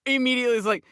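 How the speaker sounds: normal talking voice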